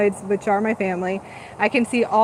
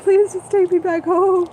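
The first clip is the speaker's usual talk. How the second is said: pleading; 8 semitones higher than usual. Retold or imitated. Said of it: "And I'm like".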